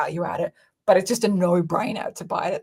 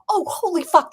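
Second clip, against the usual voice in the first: high, thrilled burst of surprise; excited exclamation; 10 semitones higher, 4 dB louder than usual. Pretend. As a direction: gasp it out like a happy buyer. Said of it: "so you can buy it and be like".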